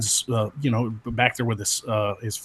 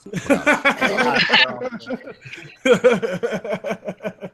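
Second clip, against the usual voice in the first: long high laughter; real high-pitched laughing fit; 10 semitones higher than usual. Real, not acted. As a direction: crack up at length. Laughing at joke.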